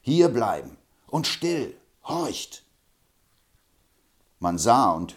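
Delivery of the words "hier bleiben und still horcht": slow, firm order; commanding. Acted. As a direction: hold him back with a stern command. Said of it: "hielt ihn fest und gebot".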